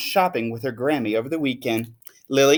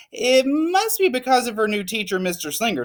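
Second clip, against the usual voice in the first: knowing mother; 8 semitones higher than usual. Acted. Mother explains.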